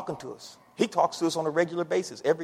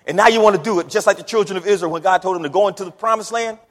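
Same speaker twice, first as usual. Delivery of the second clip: ordinary talk, nothing marked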